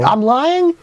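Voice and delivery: high, outraged "I'm lying?"; indignant disbelief. Real, not acted. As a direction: sound shocked at the accusation.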